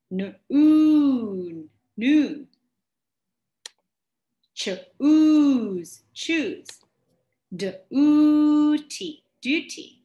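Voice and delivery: loud, split "n, oon, noon", "ch, ooze", "d, ooty"; teacher sounding out words loudly; sounding out long oo. Shown as a demonstration. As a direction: separate each onset and hold "oo". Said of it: "The long sound would be".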